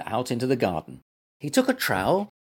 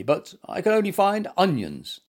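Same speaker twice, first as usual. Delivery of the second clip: higher-pitched polite reply; 7 semitones higher than usual. A narrator's reading. Gumper explaining himself to the gardener, polite. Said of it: "replied Gumper politely".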